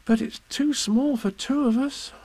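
put-on high, slow, downcast boy; sad. A narrator's reading. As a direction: lament it slowly as a little boy. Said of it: "said Christopher Robin sadly".

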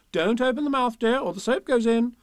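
put-on high, brisk warning to a child; motherly Kanga. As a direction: chide lightly while busy. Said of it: "said Kanga".

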